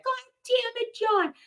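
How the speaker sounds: high, soft squeaky imitation of an angry wife; mock Karen. Retelling Karen cursing John, high and squeaky. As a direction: repeat her curse in a thin high voice. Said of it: "That's what she said".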